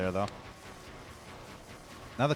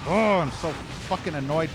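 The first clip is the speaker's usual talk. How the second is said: louder, higher angry cry; real exasperated rage; annoyed outburst; 5 semitones higher, 5 dB louder than usual. Real, not acted. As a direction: let the fury burst out. Raging at the map.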